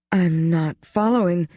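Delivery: usual speaking voice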